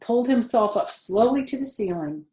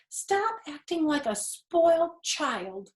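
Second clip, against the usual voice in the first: put-on higher, slow, scolding young voice; scolding pig; 9 semitones higher, slower than usual. Wilbur scolding the rat for sulking. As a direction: tell him off like a cross child.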